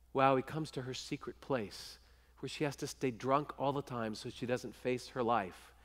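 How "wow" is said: normal talking voice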